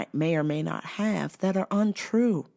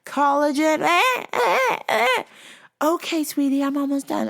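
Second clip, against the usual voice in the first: much higher mock caller; sweet, clueless caller; squeaky mock customer; 11 semitones higher, 6 dB louder than usual. Caller voiced in retelling.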